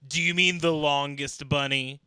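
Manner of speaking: loud, mock correcting question; voicing Google. Host retells Google correcting a search, loud mock query. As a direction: ask it loudly as a pedantic search engine.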